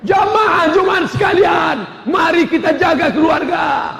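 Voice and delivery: high, loud preacher's call, slowed; pulpit shout. Retold or imitated. Khotib addressing Friday congregation, high loud preaching. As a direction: boom it out from the pulpit.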